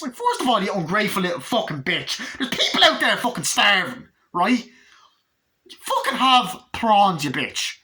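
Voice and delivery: high, loud comic tirade; loud, exaggerated scolding; mock-outraged rant. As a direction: tear into the kid in hammed-up outrage. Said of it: "had to fucking get that rant out".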